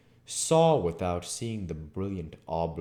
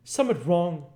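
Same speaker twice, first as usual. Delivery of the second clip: high, loud rustic landlord voice; a country landlord, puzzled and firm; rustic innkeeper; 13 semitones higher, 8 dB louder than usual. Acted. Innkeeper Hall reacting to the odd noise, high rustic voice. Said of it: "said Hall".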